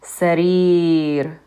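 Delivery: Sarir with a long drawn ee in the last syllable; long E demo. Shown as a demonstration. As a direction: stretch the ee in Sarir. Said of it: "That long vowel is the E sound".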